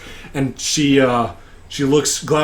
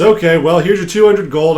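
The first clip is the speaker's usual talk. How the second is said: lightly voiced brisk official, louder; raised pitch, a touch of impatience; inconvenienced captain; 4 semitones higher, 5 dB louder than usual. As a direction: pay them off as a put-out captain. Mildly inconvenienced captain pays up.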